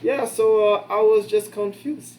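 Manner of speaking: hammy imitation of a Filipino accent. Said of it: "Terrible Filipino accent".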